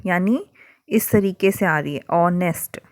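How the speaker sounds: ordinary talk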